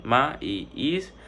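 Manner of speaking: "ma y is" slowly in two separate beats; dividido em sílabas. Shown as a demonstration. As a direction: split the hiatus, "ma" apart from "is". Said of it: "Se divide en dos".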